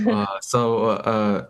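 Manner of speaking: tripping over his words; stammer, searching for words; stuttering. Retold or imitated. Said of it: "and I started stuttering".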